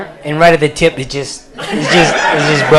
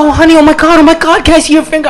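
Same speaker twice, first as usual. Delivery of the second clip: much higher, louder, fast fretting; worried teacher; 12 semitones higher, 3 dB louder, faster than usual. Retold or imitated. Teacher fussing over pupil's finger, high fretting. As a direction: gush over a hurt kid. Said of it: "She's like".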